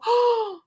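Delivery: very high theatrical gasp; delighted gasp. Shown as a demonstration. Reading the board book to a baby, high mock-surprised gasp. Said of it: "read it with an enthusiastic tone of voice".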